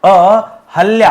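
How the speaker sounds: अहल्या drawn out slowly, each syllable clear, rising at the end; careful syllables. Shown as a demonstration. Teacher to students, slowly spelling out अहल्या. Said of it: "अहल्या शब्द होता है".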